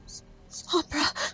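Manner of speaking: put-on a shaken girl barely daring to speak; frightened stammer. Hope recoils from raging Sombra, frightened stammer.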